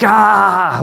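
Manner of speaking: high breathy release cry; release sigh. Pretend. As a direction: let out a high relieved ah. Earth releasing something.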